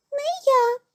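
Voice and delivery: very high little mouse refusal; high-pitched storybook squeak; squeaky mouse. A narrator's reading. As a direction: pipe it up high like a tiny mouse. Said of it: "пищить круть".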